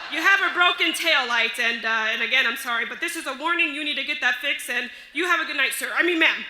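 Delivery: loud, hurried official warning; flustered officer. Retold or imitated. Officer recovering from calling her sir, flustered and loud. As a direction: push through the warning loudly, then blurt the correction. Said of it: "He comes up to me and says".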